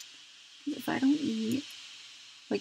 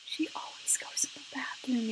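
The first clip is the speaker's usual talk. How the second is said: whispered aside; 4 dB quieter than usual. Real, not acted. Aside to viewers about herself.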